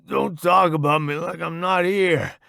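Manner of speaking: mildly indignant griping; bitching. A narrator's reading. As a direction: complain at being talked over. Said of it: "Johnson bitches".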